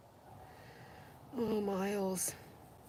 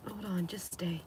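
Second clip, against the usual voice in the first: whispered; 9 dB quieter than usual. Real, not acted. Whispering to the dog.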